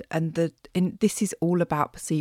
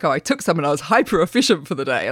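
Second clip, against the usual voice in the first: mock smug; 4 semitones lower, faster than usual. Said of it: "people that are like".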